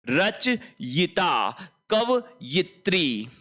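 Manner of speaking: drawn-out रचयिता and कवयित्री; teacher's slow model pronunciation; very slow demo. Shown as a demonstration. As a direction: spell the words out slowly. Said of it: "दोनों आपको ध्यान में रखनी है".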